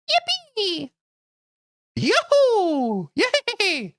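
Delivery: very high squeaky cartoon cheers; silly cheer. Streamer doing a cute creature cheer for chat. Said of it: "I sound like a Spore character".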